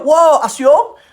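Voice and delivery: high, loud impressed neighbour; hammed-up amazement, pitched well above normal; gushing praise. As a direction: imitate excited coffee-shop flattery. Acting townsfolk praising the pastor's father.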